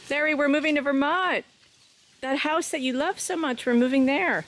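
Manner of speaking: high, excited pet talk. Real, not acted. High baby talk to dog.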